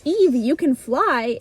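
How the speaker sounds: slow, drawn-out amazed words; awestruck. Retold or imitated. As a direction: say it slowly in awe. Repeated slowly in awe.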